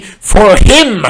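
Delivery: high, loud theatrical punch; villain's dramatic declaration. Acted. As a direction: hurl it out as a villain's big reveal. Villain declares his purpose.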